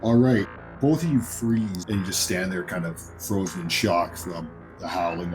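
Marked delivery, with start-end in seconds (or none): none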